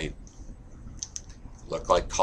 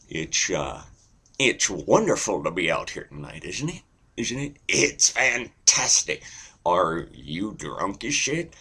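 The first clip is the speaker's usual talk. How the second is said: banter like a host, stretching words; Johnny Carson. Shown as a demonstration. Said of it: "always there's Johnny Carson".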